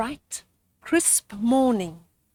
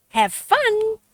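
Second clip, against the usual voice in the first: higher, louder call; 10 semitones higher, 5 dB louder than usual. Acted. Mother mouse calling to children heading out, louder. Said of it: "Mums called".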